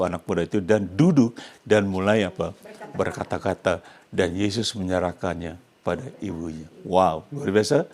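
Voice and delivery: normal talking voice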